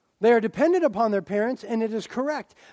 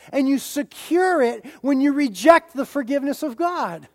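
fervent confession; 4 semitones higher, 5 dB louder than usual. Pretend. Pastor voices a believer confessing to God, fervent and loud. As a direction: proclaim the confession with full force. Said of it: "come to God with the same thing".

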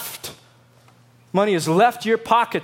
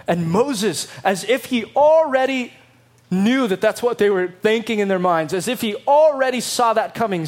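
normal talking voice

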